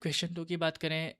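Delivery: normal talking voice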